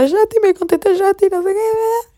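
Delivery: high, loud, thrilled squeal; excited squeal. Retold or imitated. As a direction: squeal it like a crazy fan. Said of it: "yo diciendo". (chillido agudo y emocionado de fan)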